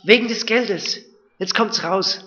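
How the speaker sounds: anxious, pressing quote; fearful brothers. Acted. Brothers fearing a trap, anxious. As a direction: voice the brothers' fear.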